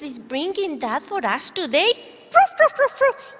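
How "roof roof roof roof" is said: high yappy puppet barks; puppy barks. Acted. Puppet dog waking up for the kids, high happy barks. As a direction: bark like an eager little dog.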